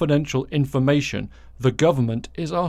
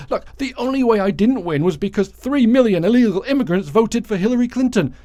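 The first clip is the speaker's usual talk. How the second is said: higher, louder, insistent rant; ranting Trump, in his mind; 7 semitones higher, 4 dB louder than usual. Retold or imitated. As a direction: voice a sore loser's loud, high-pitched excuse-making. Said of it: "So in his mind, he says".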